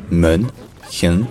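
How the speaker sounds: "Mönchengladbach" broken slowly into its German parts; slow German model of "Mönchengladbach", pausing between syllables. Shown as a demonstration. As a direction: separate Mön, chen, glad, bach. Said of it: "Donc le nom complet".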